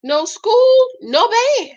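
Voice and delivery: high-pitched emphatic mock refusal; dad refusing. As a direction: lay down the law in a high, exaggerated voice. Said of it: "And his dad said".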